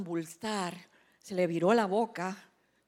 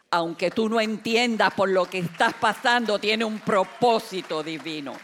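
louder, forceful declaration; emphatic preaching; 5 dB louder than usual. Real, not acted. Preacher repeating divine purpose to listeners, emphatic and loud. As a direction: drive it home to the congregation.

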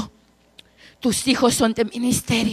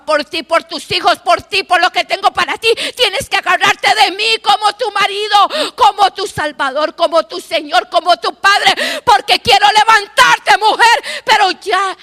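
rising, louder prophetic cry; impassioned prophecy building louder and higher; full-force preaching; 9 semitones higher, 5 dB louder than usual. Acted. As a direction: preach it out, climbing to a peak.

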